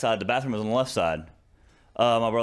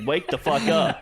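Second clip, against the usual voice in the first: higher-pitched mock scolding; retold angry wake-up; 4 semitones higher, slower than usual. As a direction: imitate an exasperated brother waking someone. Brother hitting him awake, retold.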